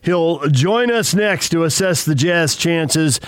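high, bright host tease; radio announcer. Acted. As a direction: hype the next segment like a radio host. Radio host teases the next segment.

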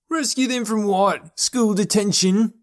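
slow, high-pitched incredulous jeer; demanding. A narrator's reading. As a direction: demand it slowly, pitched right up. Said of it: "a fellow tree dweller demanded".

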